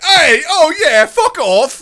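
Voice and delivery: high, loud mock British yell; hammed-up, blunt outburst flung at the questioner; Keira Knightley. Host contrasting the actress's rude response, shouted mock anger. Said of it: "she was like".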